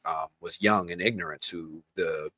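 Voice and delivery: ordinary talk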